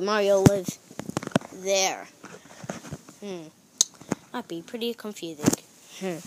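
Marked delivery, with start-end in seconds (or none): none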